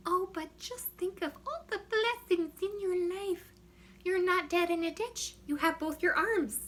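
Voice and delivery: put-on high-pitched, sing-song Minnesota accent. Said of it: "talking like I'm from Minnesota".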